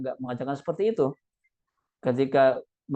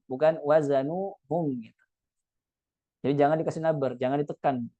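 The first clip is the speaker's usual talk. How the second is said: normal talking voice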